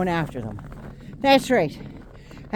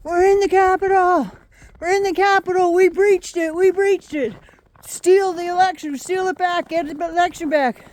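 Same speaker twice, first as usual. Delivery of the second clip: higher mocking chant of rioters; mock screaming rioters; 7 semitones higher than usual. Ranter mimics rioters at the Capitol, mock yelling. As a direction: yell it like a gloating mob. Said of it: "started screaming".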